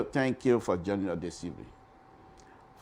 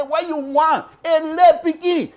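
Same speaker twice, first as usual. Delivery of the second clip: much higher and louder, mocking the newcomer; scornful crowd; 13 dB louder than usual. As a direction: sneer it like the whole crowd laughing him off. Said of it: "They say".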